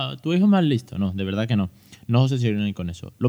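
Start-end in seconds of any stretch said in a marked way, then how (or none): 0.1-1.0 mocking other parent. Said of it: "Es decir".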